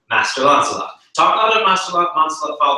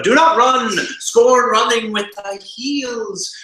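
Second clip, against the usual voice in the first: higher, louder stern warning; stern conscience; 8 semitones higher, 4 dB louder, slower than usual. Acted. Conscience warning Lancelot not to flee, raised stern voice. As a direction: forbid him to run, slow on the second line.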